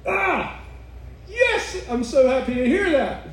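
high-pitched delighted cry; overjoyed. Acted. Answering God's claim of love, overjoyed. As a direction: cry out yes with joyful relief, voice leaping up. Joyful reaction to God's words.

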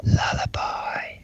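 slow, drawn-out whisper. Shown as a demonstration. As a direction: stretch the word in a hush. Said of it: "Too loud".

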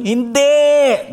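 high, loud flat denial; emphatic no. Retold or imitated. Winner refuses the millionaire's guess, loud no. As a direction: snap back a firm no.

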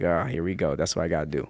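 quiet mock resignation at paying extra; resigned mutter. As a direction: give in quietly to the resale price. Resigned to paying resale price.

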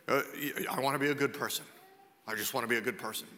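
low, soft everyman voice; earnest modest claim. Retold or imitated. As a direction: say it low and humbly, as everyone does. Voicing everyone's claim to be good.

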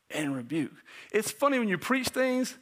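normal talking voice

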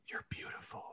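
soft, unvoiced whisper. A narrator's reading. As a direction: whisper it tenderly. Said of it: "Banjo whispered".

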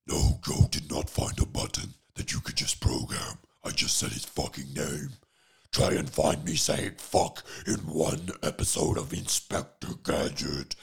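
very loud, growling villain rasp; Doctor Claw. Acted. Insisting it is no soundboard, loud guttural villain growl. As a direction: growl it with cartoon-villain menace. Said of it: "Doctor Claw impression".

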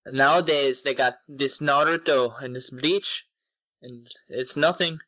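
playful put-on Scottish brogue; Scottish accent. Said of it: "Do, do your Scottish voice for him".

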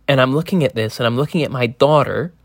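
usual speaking voice

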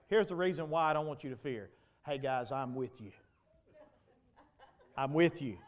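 a hint of a captain calming passengers; reassuring captain.

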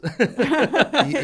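loud, high laugh at himself; real sheepish laugh; embarrassed laugh. Real, not acted. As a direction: laugh off the slip. Laughs at forgetting acronyms.

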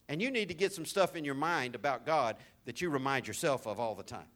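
usual speaking voice

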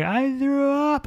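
high, slow little-kid voice, clearly put on; a sick kid owning up, drawn out; daughter's small voice. Dad voicing his daughter's confession, high and slow. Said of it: "And she was like".

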